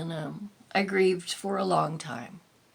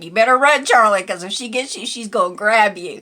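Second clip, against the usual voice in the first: louder, quicker mock alarm to the cat; hammed-up urgent tease; mock warning, half laughing; playful warning; 4 semitones higher, 9 dB louder, faster than usual.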